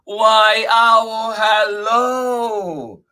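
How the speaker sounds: high, loud mouse hello; calling out greeting. Acted. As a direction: sing out a big drawn-out hello. Mouse calls a greeting to Owl.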